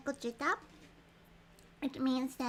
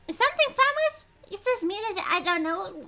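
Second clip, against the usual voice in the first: much higher, louder bewildered heroine; high surprised character voice; 6 semitones higher, 10 dB louder than usual. Acted. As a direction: play her as startled and lost. Protagonist's line in game.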